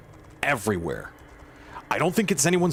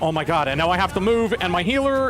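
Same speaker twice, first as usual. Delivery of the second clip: panicked; 6 semitones higher than usual. Real, not acted. Healer panicking as the group dies.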